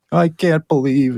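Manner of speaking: mock shaky, wounded voice; quavering, hurt tone. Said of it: "in shaky voices".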